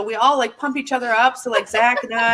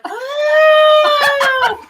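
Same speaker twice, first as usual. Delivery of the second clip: shouted, much higher and louder oh; the penny drops all at once; sudden realization; 10 dB louder than usual. Retold or imitated. Retold realization.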